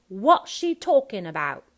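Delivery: "what" and "about" without their t, loud; punchy Cockney ABOUT, t left off; dropped final T. Shown as a demonstration. Coach modelling Horace's question, dropped final T. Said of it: "on what or about".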